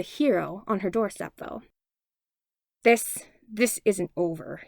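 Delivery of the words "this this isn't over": slow faltering growl; retreating threat. A narrator's reading. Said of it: "he growled".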